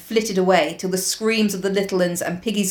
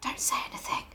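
whispering; 14 dB quieter, faster than usual. Acted. Hushed in the dark.

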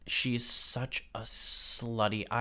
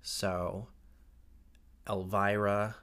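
incantation. Pretend. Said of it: "hear my prayer".

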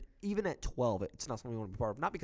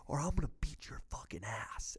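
menacing whisper; 2 semitones higher, 5 dB quieter than usual. Retold or imitated. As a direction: hiss the threat under your breath. Threatening drunk guy at game.

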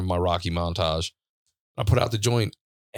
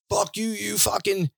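high-pitched mock yell; retold high, strained outburst; imitated yelling; 12 semitones higher than usual. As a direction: imitate an enraged man hollering insults from afar. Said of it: "keeps yelling, keeps yelling".

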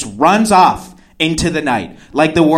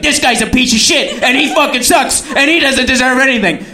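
high, punchy mock rant; hype-man cursing; 7 semitones higher than usual. Woman following him home cursing, imitated hype-man rant. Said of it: "cursing me out like a reverse rap hype man".

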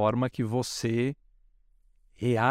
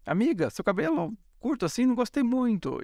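friend's critique; 10 semitones higher than usual. Retold or imitated. Friend criticising short haircut.

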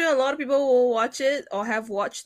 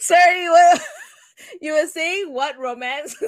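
laughing; 8 semitones higher, 9 dB louder than usual. Real, not acted. Laughing while apologising.

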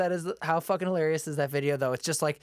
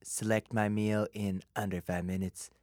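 low, boastful mock president voice; Donald Trump; 7 semitones lower than usual. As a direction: sink lower and brag grandly. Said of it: "some dumb shit Trump would say".

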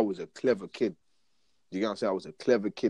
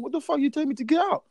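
high, outraged retold question; indignant protest. Pupil challenging the teacher who sent him out, indignant. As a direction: challenge it in a raised pitch.

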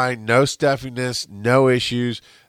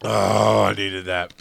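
ice in mouth; 2 semitones lower, slower than usual. Real, not acted. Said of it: "Sorry about crunching ice on the mic".

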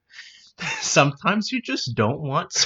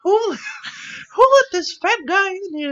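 higher dopey cartoon voice; higher goofy cartoon flunky voice; cartoon sidekick voice; 3 dB louder than usual. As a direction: play the dim board member raising a hand. Member in silly voice.

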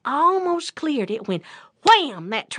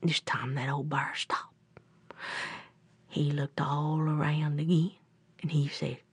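low, soft storyteller hush; hushed suspense; 7 semitones lower, 5 dB quieter than usual. A narrator's reading. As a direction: drop low and quiet to build suspense. Hushed suspense as bear stops.

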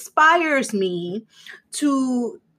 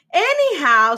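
normal talking voice

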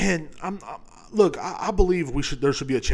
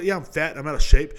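usual speaking voice

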